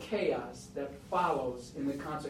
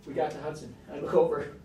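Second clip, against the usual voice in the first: ordinary delivery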